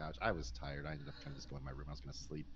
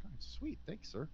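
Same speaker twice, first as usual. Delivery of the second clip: much higher, half-asleep eager reply; stunned eager thanks; 13 semitones higher than usual. Retold or imitated. Accepting the contract offer from the boss, high and eager. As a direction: squeak out thanks in disbelief.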